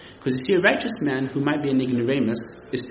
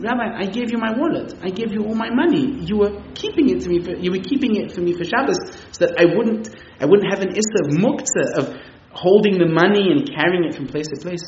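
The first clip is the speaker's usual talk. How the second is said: higher, urgent guest begging for his money; pleading guest; 6 semitones higher, 5 dB louder than usual. Retold or imitated. Guest begging the rabbi for his wallet, higher and urgent. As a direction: press the rabbi, a bit louder.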